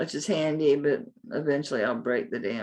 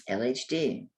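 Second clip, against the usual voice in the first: ordinary talk, nothing marked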